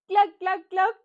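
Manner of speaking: loud, high hen clucks; three short, punched clucks; imitación de gallina. Shown as a demonstration. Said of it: "¿Cómo hace la gallina?". (cloqueo agudo y fuerte)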